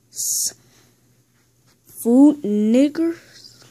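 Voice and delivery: very slow, rising disbelief; play-acted double-take, pitch climbing at the end; incredulous question. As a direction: draw it out into a stunned question. Questioning a misheard phrase.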